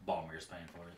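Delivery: low, soft stage whisper with some voice; hushed aside. Acted. Innkeeper leaning over to tip off a guest, hushed aside. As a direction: lean in and say it under your breath. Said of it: "he's gonna whisper".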